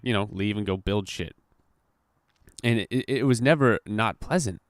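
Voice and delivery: ordinary delivery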